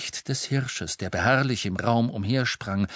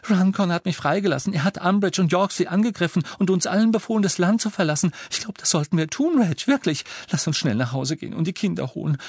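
high, rushed woman's voice; excited relieved wife; 9 semitones higher, 4 dB louder, faster than usual. Acted. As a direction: gush the news. Relieved wife pours out news.

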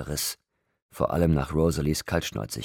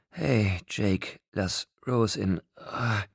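higher voice, slowing into a gasp; breaking off with a gasp; 6 semitones higher, much slower than usual. A narrator's reading. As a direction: cut short by a sharp intake of breath. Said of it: "Mitten im Satz brach Bella ab und zog Luft ein". (mitten im Satz keuchend abbrechen)

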